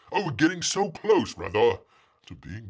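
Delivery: usual speaking voice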